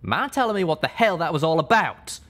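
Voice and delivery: angry question. Acted. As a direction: demand an answer. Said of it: "Calamity asked angrily".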